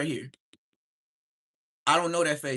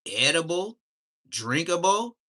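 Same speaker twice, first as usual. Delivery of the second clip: ordinary delivery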